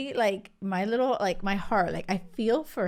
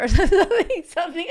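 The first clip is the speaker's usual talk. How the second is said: laughing aside; 9 semitones higher, 8 dB louder, faster than usual. Real, not acted. Laughs at her own quote.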